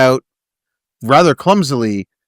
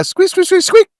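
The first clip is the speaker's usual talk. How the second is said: squeaky wheel noise. Retold or imitated. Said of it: "echoing the squeak".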